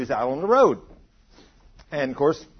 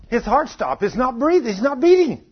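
raised-pitch urgent paramedic call; alarmed report; 7 semitones higher, 4 dB louder than usual. Retold or imitated. Paramedic's alarm acted out in story.

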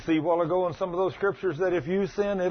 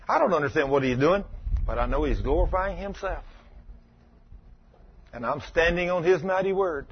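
ordinary talk

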